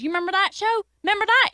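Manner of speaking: high, rising eager question; excited. Acted. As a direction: rise up excitedly. Excited reminder.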